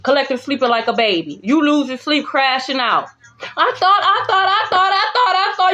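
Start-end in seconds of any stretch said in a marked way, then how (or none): 3.4-5.7 higher, sputtering mock; mocking stammer. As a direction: parody a flustered enemy.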